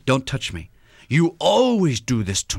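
lightly voiced wife leaning on always; annoyed wife. Wife pulling away from husband, leaning on always. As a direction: stretch out always, a bit slow. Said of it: "said with that tone of voice".

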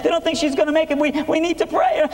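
high, frightened rush of words; terrified sister breaking news; panicked sister. Retold or imitated.

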